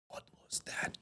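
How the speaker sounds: stunned, barely voiced whisper; shocked whisper. Reacting to the demon face in the car, shocked whisper. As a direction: whisper it in disbelief.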